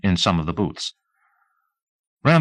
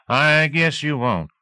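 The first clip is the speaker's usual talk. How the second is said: slow knowing reply; 7 semitones higher, slower than usual. A narrator's reading.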